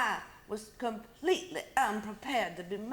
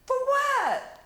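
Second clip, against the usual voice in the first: indignant; 13 semitones higher, 6 dB louder than usual. Acted. Sister indignant at Mama.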